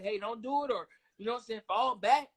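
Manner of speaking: ordinary talk, nothing marked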